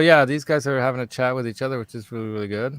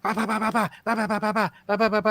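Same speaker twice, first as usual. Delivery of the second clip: high mock babble, ba ba ba; higher nonsense syllables mimicking a heated family talk; imitating relatives yapping; 11 semitones higher, faster than usual. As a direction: rattle it off high like fast foreign chatter. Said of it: "they're, like, yapping at each other".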